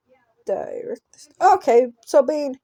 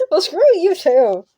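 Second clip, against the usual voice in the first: higher, louder laughing retort; 6 semitones higher, 6 dB louder than usual. Real, not acted. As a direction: toss back the insult while cracking up. Playful comeback at shopkeeper.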